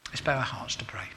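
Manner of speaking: soft whispered invitation to pray; a quiet whisper leading into prayer. Real, not acted. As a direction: hush the room and whisper it. Call to prayer.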